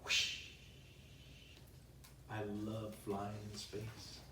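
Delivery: usual speaking voice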